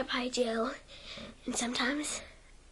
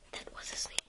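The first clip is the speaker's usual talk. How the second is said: whisper; 10 dB quieter, faster than usual. Real, not acted. Whispered asides around sneeze.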